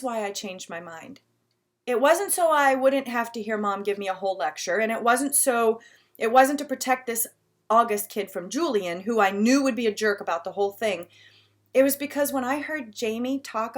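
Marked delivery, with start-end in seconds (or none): none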